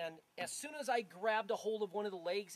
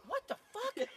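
much higher stunned disbelief; shocked exclamation; 3 dB louder than usual. Retold or imitated. Noticing the blood on his arm, a high shocked cry. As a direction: gasp it out high, seeing his red arm. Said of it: "And I'm like".

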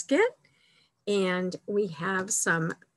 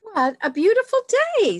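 high, loud, quick burst of delight; delighted exclamation; 13 semitones higher, 7 dB louder, much faster than usual. Acted. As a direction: exclaim it brightly as a storybook bear. Bear greets lovely morning.

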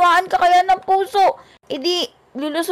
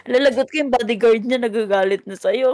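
bodyguard voice; 8 semitones lower than usual. Acted. S1 voices angry bodyguard, low.

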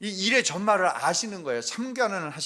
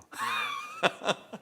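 a sudden high burst of laughter; 4 dB quieter than usual. Real, not acted. Pastor laughing about being snubbed, high rueful laugh. Laughing at mother's cold shoulder.

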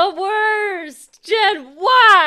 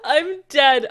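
normal talking voice

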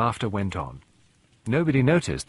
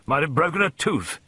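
higher-pitched, disgruntled colonel; peevish bluster; grumbling; 8 semitones higher than usual. A narrator's reading. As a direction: grumble with wounded indignation. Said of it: "he grumbled".

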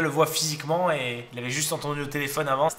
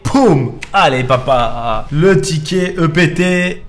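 loud, high chant of the prize; elated; 4 semitones higher, 12 dB louder than usual. Real, not acted. Streamer celebrating the prize ticket, elated. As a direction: savor the prize word by word. Excited over a won ticket.

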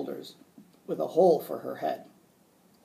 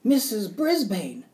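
higher, louder and drawn out; excited shout; 6 semitones higher, 6 dB louder than usual. A narrator's reading. Boy recognising his teacher in the witch, shouting. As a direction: call the name out in astonishment. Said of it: "Small Paul shouted".

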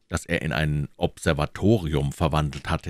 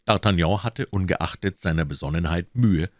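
ordinary delivery

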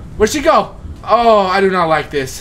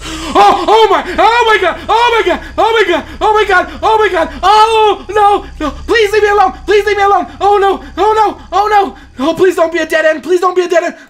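high, loud frantic pleading; panicked screaming; 14 semitones higher, 6 dB louder than usual. Real, not acted. Player begging to be left alone, fleeing toward a dead end, panicked screaming. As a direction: shriek and beg while fleeing.

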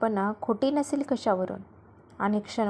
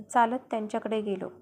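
ordinary delivery, nothing marked

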